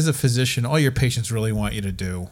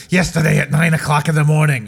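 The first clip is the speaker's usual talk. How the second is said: put-on old patient's voice; 3 semitones higher, 6 dB louder than usual. Elderly patient voiced in story.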